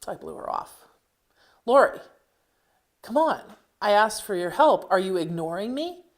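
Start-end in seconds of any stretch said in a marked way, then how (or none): 1.7-6.0 louder and higher, an irritated mother calling again; getting mad. Acted. Mother calling her ignoring daughter, getting mad and louder. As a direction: push it, she's losing patience. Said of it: "She was getting mad".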